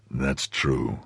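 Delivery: low, soft agreement; grave Athos. A narrator's reading. Athos agreeing with his friend, low and grave. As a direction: concede it low and quietly.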